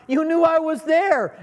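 high, startled mock complaint; startled protest. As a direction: squeak it out like someone just spooked. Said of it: "And you're like".

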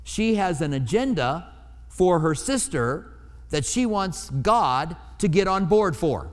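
usual speaking voice

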